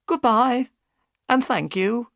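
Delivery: high, grateful woman's voice; high voice of the boatman's wife; wife's goodbye. Acted. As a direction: thank him as the relieved wife. Wife says goodbye back.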